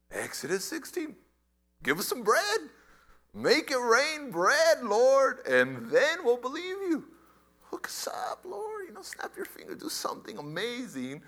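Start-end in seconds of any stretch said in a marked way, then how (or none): 1.8-7.0 raised, higher voice making a pushy demand; demanding crowd. Acted. Preacher voicing the crowd demanding a sign, loud and pushy. 7.7-11.2 high, breathy whispered plea; coaxing whisper. Acted. Preacher voicing people coaxing God for a miracle, whispered.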